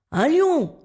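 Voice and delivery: high, loud panicked shout; terrified yell. A narrator's reading. Blackbird spots a lion, panicked yell. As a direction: scream the danger. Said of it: "hurle-t-il".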